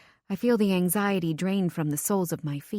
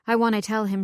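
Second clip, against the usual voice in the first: plain reading voice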